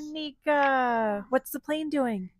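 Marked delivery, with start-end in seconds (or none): none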